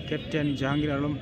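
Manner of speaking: ordinary delivery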